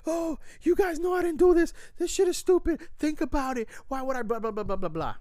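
high, loud imitation of frantic protest; high-pitched mock rant, easing off at the end; mock screaming. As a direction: mimic a shrill outburst, trailing into blahs. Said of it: "he starts screaming".

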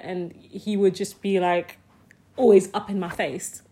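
ordinary talk

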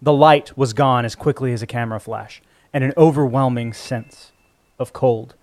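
ordinary delivery

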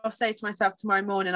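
ordinary delivery

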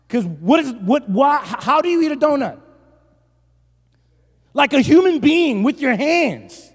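high, loud, sputtering disbelief; mock outrage. Pastor appalled at eating donuts with cutlery, mock outrage. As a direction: rant as if scandalized.

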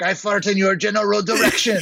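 put-on a light comic French accent; hammed-up French insult; mock French accent. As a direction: toss the line like a haughty Frenchman. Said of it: "French gibberish".

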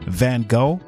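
normal talking voice

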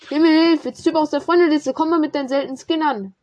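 loud, high cry for help; panicked shout. Real, not acted. Panicking at approaching player.

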